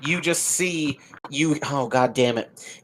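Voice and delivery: usual speaking voice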